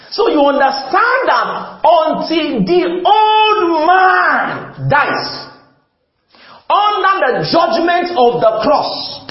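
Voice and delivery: high, loud, slow preacher's shout; rising sermon shout building to the cross; full-force preaching. Acted. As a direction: preach it out with fire.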